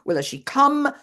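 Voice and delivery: tearful and angry. Retold or imitated. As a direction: push up on come, near breaking. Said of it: "Tearfully, but also angrily".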